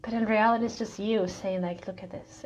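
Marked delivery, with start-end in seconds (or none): 1.9-2.4 a hushed invitation; softly, almost under the breath. Pretend. Life voiced showing something.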